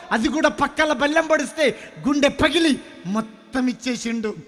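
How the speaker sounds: impassioned preaching. Acted. Preacher describes the crucifixion to the congregation: building fiery preaching. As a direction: build the passion line by line to a shout.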